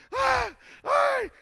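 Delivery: short, very high yelps; high mimed screams; imitated screams. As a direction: cry out sharply as the overcome young man. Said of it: "empezó a gritar".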